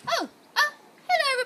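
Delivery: high startled hello; a nervous surprised greeting; startled girl. A narrator's reading. Nora startled by the polar bear, high and nervous. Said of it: "replies Nora".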